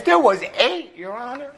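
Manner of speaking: high-pitched wife answer; put-on high wife voice; wife addressing judge.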